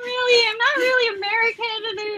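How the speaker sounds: high-pitched, half-whining self-quote; squeaky mock whine, easing down at the end; mock self-protest. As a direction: imitate your own protest in a high, plaintive voice. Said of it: "I'm like".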